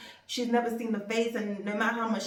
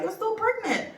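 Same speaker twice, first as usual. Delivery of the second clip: much higher, amazed; astonished doctor; 12 semitones higher than usual. Retold or imitated. Doctor telling the patient, high and amazed. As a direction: voice the doctor's disbelief. Doctor shocked she's still pregnant.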